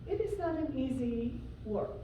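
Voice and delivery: usual speaking voice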